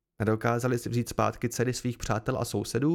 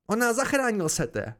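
high, raised protesting cry; výkřik; 7 semitones higher than usual. A narrator's reading. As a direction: cry out in someone's defence, pitched well up. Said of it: "vykřikl hostě". (rozhořčený výkřik)

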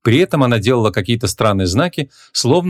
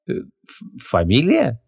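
stumbling on «фамилия» with a false start; caught-out, faltering «фамилия»; stammering; 2 semitones higher, much slower than usual. A narrator's reading. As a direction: stall on «фамилия», echoing the question uncertainly. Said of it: "запнулась Ванесса".

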